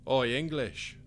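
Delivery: loud call across the room. A narrator's reading. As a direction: shout it to catch his attention. Calling out at a door.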